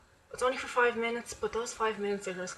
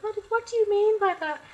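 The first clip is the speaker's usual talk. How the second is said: high, loud puzzled imitation; confused friend; 13 semitones higher, 8 dB louder than usual. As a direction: ask it like you're baffled. Friend's confused reply voiced.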